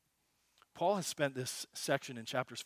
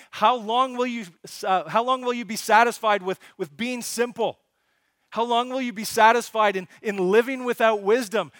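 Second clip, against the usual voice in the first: louder, higher voice of Wisdom calling to the street; Wisdom crying out; 7 semitones higher, 11 dB louder than usual. Acted. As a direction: plead with the simple like a woman in the street. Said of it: "she's crying out and she's saying".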